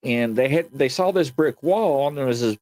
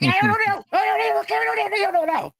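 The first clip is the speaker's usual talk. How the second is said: high, frantic repeated mutter of fear; panicked babbling. Retold or imitated. Boy by the wall, telling himself he doesn't know, in high panic. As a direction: babble it over and over in shock.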